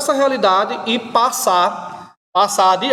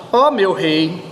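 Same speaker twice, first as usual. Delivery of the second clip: a light Bahian accent, easygoing mock robber; a laid-back Bahia robber, higher and friendly; 3 semitones higher than usual. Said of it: "esse assaltante baiano".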